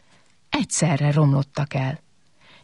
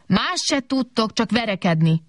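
mild, higher-pitched telling-off; scolding; 5 semitones higher than usual. A narrator's reading. Kobak fed up with the fighting robots, scolding. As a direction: tell them off without raising volume. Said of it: "szólt rájuk Kobak".

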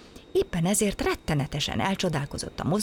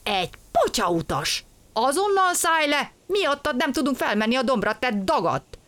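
angry engineer; 8 semitones higher than usual. A narrator's reading. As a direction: chase the stowaway off angrily. Engineer scolds stowaway. (dühös, hangos szidás)

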